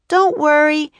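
high, loud cheerful call; calling back. A narrator's reading. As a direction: shout it back over the distance. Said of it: "she called back".

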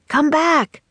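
high shouted call. A narrator's reading. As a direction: call them back to shore. Jack calling friends at sea.

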